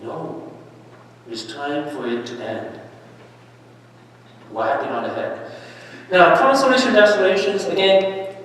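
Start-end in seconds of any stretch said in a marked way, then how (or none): 0.0-5.5 very soft, firm ending; quiet resolve. Real, not acted. Preacher quietly urges ending the lie, soft firm. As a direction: set it down softly but firmly. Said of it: "quiet firm resolve".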